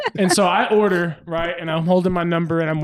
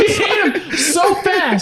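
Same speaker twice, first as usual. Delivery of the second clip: laughing; 12 semitones higher, 4 dB louder, slower than usual. Real, not acted. Laughing retelling.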